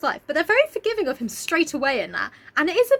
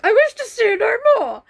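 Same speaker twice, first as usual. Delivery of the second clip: mock sad Scrooge line; 8 semitones higher, 7 dB louder than usual. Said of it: "he's really sad about".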